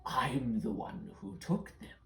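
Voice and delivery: boastful, menacing creature voice; shadow creature. Acted. Shadow creature boasting to children whose shadows he took, menacing and drawn-out. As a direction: gloat with drawn-out words, ending in a whisper.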